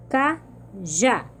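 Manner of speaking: Cajá split into two syllables, CA, pause, JÁ; sílabas separadas. Shown as a demonstration. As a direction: break Cajá apart, syllable by syllable. (Cajá separado em CA... JÁ)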